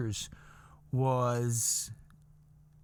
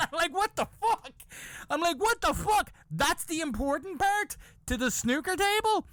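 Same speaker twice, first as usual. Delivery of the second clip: very high-pitched exasperated disbelief; incredulous outburst. Acted. Comedian reacts to the bus gossips, incredulous outburst. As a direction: protest at the absurd priorities. Comedian baffled by their priorities.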